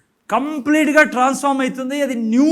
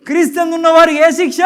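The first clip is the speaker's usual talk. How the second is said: louder and quicker, driving the point home; loud climax; 4 semitones higher, 7 dB louder, faster than usual. Real, not acted. Preacher thundering to the congregation that there is no punishment, loud. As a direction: proclaim it at full force.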